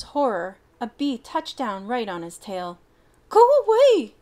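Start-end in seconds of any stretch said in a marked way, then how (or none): 3.3-4.1 very high, loud yelp; frightened yelp. Acted. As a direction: shriek at the bee. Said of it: "he yelped".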